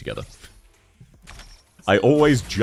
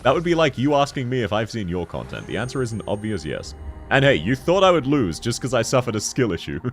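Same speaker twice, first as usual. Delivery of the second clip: ordinary talk, nothing marked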